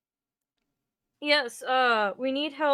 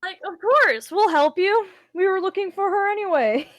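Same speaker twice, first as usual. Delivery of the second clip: higher and louder, eager yes; eager agreement; 7 semitones higher, 7 dB louder than usual. Acted. Player character eagerly agreeing to help. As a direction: jump at the offer, bright and keen.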